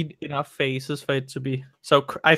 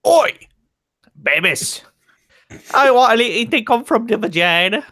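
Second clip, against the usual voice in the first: a put-on oddball Scottish-sounding accent, pitched much higher in spots; weird Scottish-ish voice; 5 semitones higher than usual. Voicing the man on the playground, weird Scottish-sounding voice. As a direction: go goofy and strange, half-Scottish. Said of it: "how do you get the fact that he's Scottish?".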